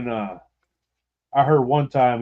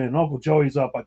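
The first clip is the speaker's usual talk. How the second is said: ordinary talk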